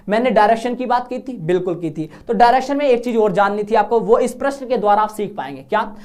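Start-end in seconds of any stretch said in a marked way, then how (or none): none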